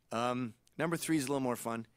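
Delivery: ordinary talk, nothing marked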